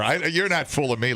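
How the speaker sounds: normal talking voice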